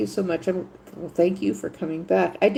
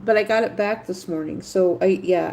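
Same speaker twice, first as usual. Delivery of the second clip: ordinary delivery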